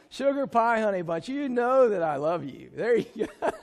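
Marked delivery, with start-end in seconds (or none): 0.1-2.7 spoken coaxing line. Shown as a demonstration. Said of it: "Will you say that? Come on, guys". 3.7-3.6 laughing. Real, not acted. Laughing at the cheesy lyric.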